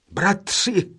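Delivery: joyful cry. A narrator's reading. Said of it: "vzkřikl radostně nejstarší".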